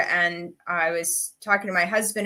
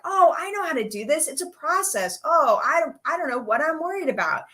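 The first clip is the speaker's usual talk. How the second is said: higher-pitched "oh!" of sudden recognition; retold relieved realization; 8 semitones higher than usual. Author rereading her own book, acting out relieved realization. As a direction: reenact it dawning on you, pitch lifted.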